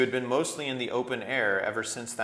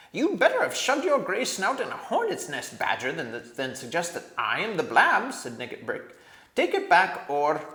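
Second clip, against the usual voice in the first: much higher, loud furious dwarf; angry dwarf; 8 semitones higher, 8 dB louder than usual. A narrator's reading. Nickabrick snapping at the king, high and furious. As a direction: spit it out with bitter rage.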